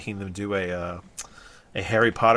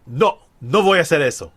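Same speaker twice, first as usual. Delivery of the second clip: higher, louder switch into Spanish; Spanish accent; 5 semitones higher, 6 dB louder than usual. Shown as a demonstration. Speaker showing no when talking Spanish to family, Spanish accent. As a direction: say it in Spanish with short pure vowels. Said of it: "talking Spanish and it's like".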